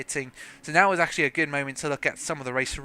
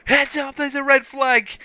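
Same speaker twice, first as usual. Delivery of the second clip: high, loud alarm call; software alert; 10 semitones higher, 8 dB louder, slower than usual. Acted. Software warning the commentator of a red flag, high loud alert. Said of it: "when the software goes".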